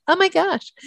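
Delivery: high, hammed-up excitement; mock your own gushing in a high voice; super excited. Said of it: "start to feel just super excited".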